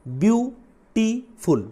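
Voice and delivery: "Beautiful" slowly split as view, टी, फुल; syllable by syllable. Shown as a demonstration. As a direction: say "beautiful" high in three separated beats. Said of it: "जिसमें, अ, दो से ज़्यादा हो".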